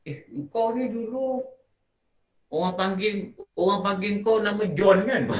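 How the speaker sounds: high, mocking tease; teasing old schoolmate. Teasing the new boss about his old nickname, mocking.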